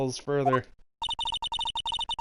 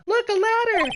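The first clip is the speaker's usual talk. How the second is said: much higher and louder, thrilled discovery; excited shout; 8 dB louder than usual. Acted. Maya spotting the ladder, high shout. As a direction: call out the find eagerly.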